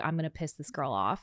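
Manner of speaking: low, soft retold aside; imagined sly scheming. Imagined driver plotting to himself, low sly murmur. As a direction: drop it down like a private plan. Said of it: "was like".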